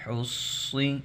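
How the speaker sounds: slow drawn-out syllable recitation; demonstration reading. Shown as a demonstration. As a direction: model the reading for the pupils. Teacher recites the spelling drill. (ہجے پڑھ کر دکھانا)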